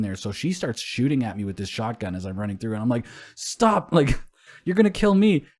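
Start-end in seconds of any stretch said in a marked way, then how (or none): 3.3-5.5 high, loud cry to stop; retold alarmed shout. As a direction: shout a panicked warning, replayed for the story. Retold plea while being shot at.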